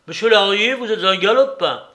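put-on loud old man's scolding; angry scolding. Old Soupe scolds Larrier, loud and angry. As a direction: tell the young rascal off loudly.

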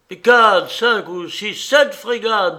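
loud, high, stamped-out numbers; irate captain's outburst; angry counting. A narrator's reading. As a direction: count them off in mounting exasperation. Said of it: "dit le capitaine en frappant du pied".

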